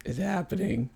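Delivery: very soft, forlorn confession; hushed, downcast imitation of younger self; retold sadness. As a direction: break the news to mom quietly, glumly. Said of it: "I was so sad".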